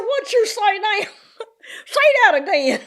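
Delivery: high, loud country twang; baffled kid twanging, on the edge of laughter; twanging country child. Retold or imitated. Country child baffled by the teacher, twanging. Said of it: "he's the reason why I don't twang".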